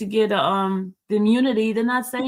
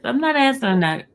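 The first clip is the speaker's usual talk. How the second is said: usual speaking voice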